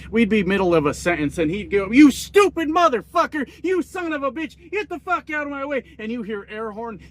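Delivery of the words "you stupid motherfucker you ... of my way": high-pitched road-rage outburst, acted out; imitated angry cussing. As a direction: burst out cussing like an enraged driver. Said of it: "he'd just be cussing everyone out".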